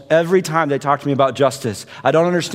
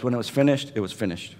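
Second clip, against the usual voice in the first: hushed; 6 semitones lower, 9 dB quieter, faster than usual. Real, not acted. Preacher hushing his congregation on the finality. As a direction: let the words fall away into silence. Quiet sermon climax.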